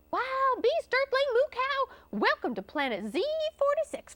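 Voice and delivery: high, put-on space cowboy voice; high-pitched, stretched alien greeting; excited alien welcome. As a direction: hail the newcomer in a high voice. Alien greets the captured cow.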